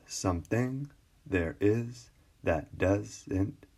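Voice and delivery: someTHING, there IS, that DOESn't, in separate beats; measured foot by foot, pausing after each pair; scanning iambic meter. Shown as a demonstration. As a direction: lift THING and IS with a rise. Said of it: "The line means it's stressed".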